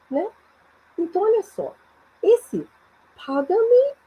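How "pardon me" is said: high rising question; "pardon me" lifted high, asking to hear it again. Shown as a demonstration. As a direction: ask it as if you didn't catch what was said. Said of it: "quando a gente não entende".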